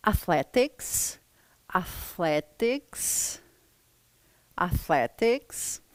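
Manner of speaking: drawn out athletics with a rise; slow repeat-after-me. Shown as a demonstration. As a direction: stretch athletics slowly for learners. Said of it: "Repita uma vez cada uma dessas palavrinhas". (athletics bem devagar, subindo no final)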